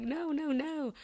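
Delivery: high, light no-no-no; self-scolding. Retold or imitated. Shopper stopping her own temptation, mock scolding. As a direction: gently tell yourself off.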